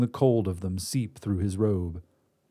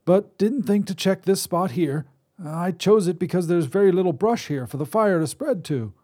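put-on higher reedy old man's voice; reedy old wizard; 8 semitones higher than usual. Wizard voice held.

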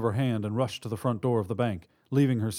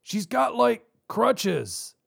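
tough robber voice; 13 semitones higher, 4 dB louder, much slower than usual. Acted.

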